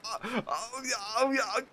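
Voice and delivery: high, soft breathy moans of a caller; mock moaning caller. Imitating a hotline caller moaning softly. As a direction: moan it like a creepy caller. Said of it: "they'll be like".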